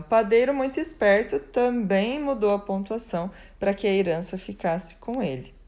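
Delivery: ordinary talk, nothing marked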